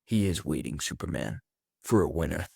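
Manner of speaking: low, deliberate comic-book villain; Lex Luthor. Retold or imitated. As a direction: drop your pitch and space out the phrases. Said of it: "And Luthor's like".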